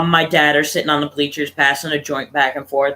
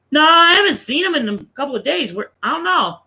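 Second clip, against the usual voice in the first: higher, lying grandmother on the phone; voicing the lying grandmother; 8 semitones higher than usual. Grandmother lies to the wife on the phone, higher voice. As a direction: pitch it up as the covering mother.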